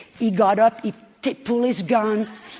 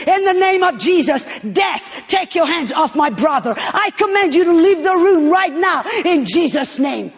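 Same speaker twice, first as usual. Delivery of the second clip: loud, higher commanding rebuke of death; prophetic command; 7 semitones higher, 6 dB louder than usual. Retold or imitated. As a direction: proclaim it with full authority, almost shouting. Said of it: "And I heard myself say".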